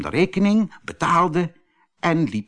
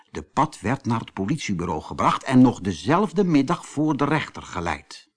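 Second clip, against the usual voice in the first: plain reading voice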